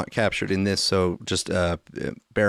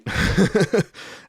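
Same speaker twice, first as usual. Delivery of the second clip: laugh; 11 semitones higher than usual. Real, not acted. Laughs at damned if you do.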